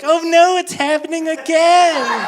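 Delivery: high, loud mock panic, slowing at the end. Comic reliving school-days panic, high and loud. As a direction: wail it like old teenage dread.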